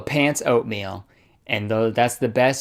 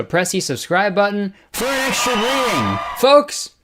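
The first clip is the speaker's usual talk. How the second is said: hyped shout; 7 semitones higher than usual. Real, not acted. Vlogger hyping subscribe button to viewers, higher excited shout.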